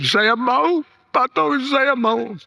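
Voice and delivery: high, slowing, emotional yes; pleading consent. Retold or imitated. Dying man telling his pastor he is willing, pleading consent.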